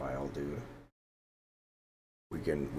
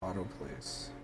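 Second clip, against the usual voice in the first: much softer, under the voice; quiet offhand command; 9 dB quieter than usual. Real, not acted. Player trying auto place to herself, quiet and offhand. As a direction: say it softly to yourself.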